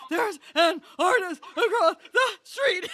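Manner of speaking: panicked voice. Retold or imitated. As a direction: wail it to your son in a panic. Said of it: "talking like this".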